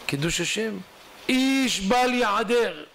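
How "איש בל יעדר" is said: high, slow, drawn-out announcement; proclamation. Acted. Herald summoning everyone to the synagogue, proclaiming. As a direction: call it out slowly like a town crier.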